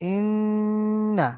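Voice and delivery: "ইন্না" chanted slowly with long nasal hum on the nn; "ইন্না" with a drawn nasal ghunna; nasalised inna. Shown as a demonstration. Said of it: "ইন্না গুন্না হবে কেন?".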